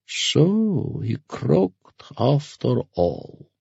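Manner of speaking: very slow, high swooping drawl; relieved but disappointed. Acted. Masked ferryman on her father's death, relieved yet disappointed. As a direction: let the words sigh out, stretched long. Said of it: "sounding relieved, but also disappointed".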